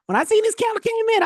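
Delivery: thrilled the price finally moved; excited relief. Retold or imitated. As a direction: shout it out as the candle finally comes. Relief as the trade turns.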